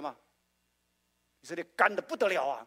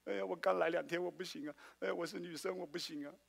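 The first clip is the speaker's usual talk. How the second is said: whining excuses; 5 dB quieter than usual. Retold or imitated. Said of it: "你不要说".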